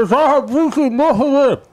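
put-on high, mouth-full accented order; drive-thru order voice. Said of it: "pull into the McDonald's line".

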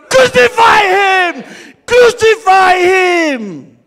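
loud, high, drawn-out crowd roar; angry mob shout. Pretend. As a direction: scream it with all your anger. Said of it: "With all your strength and anger".

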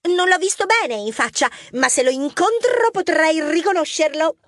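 put-on high child voice, eager retelling; little girl witness. Young mouse Mar describing the thief to police, high child voice. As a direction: tell it like a keen young witness.

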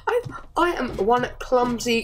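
ordinary delivery

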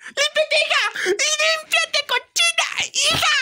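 high-pitched, shouted motherly scolding. Acted. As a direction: yell at the daughter in a high voice. Mother scolding bleeding daughter.

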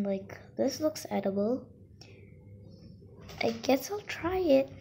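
normal talking voice

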